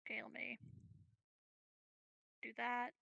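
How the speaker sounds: usual speaking voice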